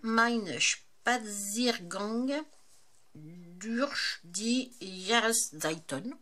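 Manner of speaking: slow, careful German accent on the title. Shown as a demonstration. Reading a German book title to her viewers, slowly in a German accent. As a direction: sound out the German title in a self-conscious German accent. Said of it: "mon accent allemand".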